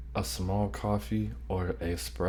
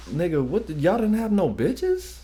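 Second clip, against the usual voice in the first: incredulous outburst; 11 semitones higher, 3 dB louder than usual. Real, not acted. Reacting in disbelief to clip.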